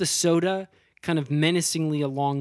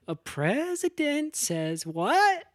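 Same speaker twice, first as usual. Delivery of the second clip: singsong; 10 semitones higher, much slower than usual. Retold or imitated. Character trick question, sing-song.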